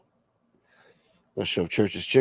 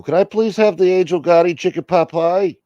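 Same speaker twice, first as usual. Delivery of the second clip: higher, louder ordering voice; put-on customer placing an order; drive-thru customer; 8 semitones higher, 6 dB louder than usual. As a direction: call the order up to the speaker.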